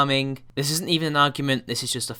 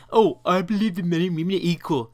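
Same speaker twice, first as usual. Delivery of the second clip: mocking imitation; 3 semitones higher than usual. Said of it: "is like".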